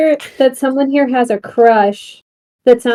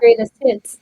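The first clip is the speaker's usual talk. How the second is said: ordinary delivery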